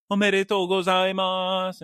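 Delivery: imitating couple saying omedetou gozaimasu; Japanese congratulations. Couple celebrating newlyweds, cheerful Japanese congratulations. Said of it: "they were all having a great time saying".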